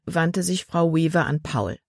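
plain reading voice

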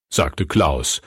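ordinary talk, nothing marked

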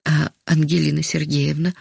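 usual speaking voice

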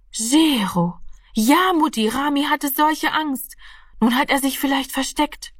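put-on alarmed child. A narrator's reading. Martina tells her mother about Rami's fear, high and alarmed. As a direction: blurt it out to Mum, high and anxious.

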